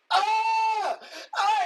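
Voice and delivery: loud, high agonized scream; imitated scream. Imitating the burning man at the film's end, screaming. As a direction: scream like you're on fire, hammed up. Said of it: "He's just screaming for".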